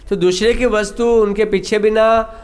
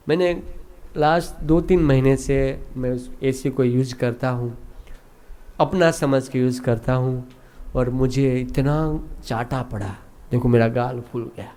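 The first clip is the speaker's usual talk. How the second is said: low, soft, matter-of-fact confession; servant's calm explanation; 6 semitones lower, 6 dB quieter than usual. Acted. Servant tells how he got slapped for using the AC, quiet and low. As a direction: reason quietly in a lower voice. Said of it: "नौकर कहता है कि".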